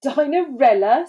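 scornful rising question; scoffing. A narrator's reading. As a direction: scoff at the very name. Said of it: "scoffed Dora".

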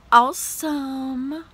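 drawn-out hype. Real, not acted. Hyping favorite YouTuber.

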